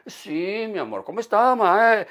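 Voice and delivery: high, sing-out Spanish intonation; Spanish accent demo. Shown as a demonstration. Man showing off a Spanish accent to the audience, exaggerated intonation. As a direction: exaggerate the lilting Spanish tune, swelling at the end. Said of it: "You know, the intonation".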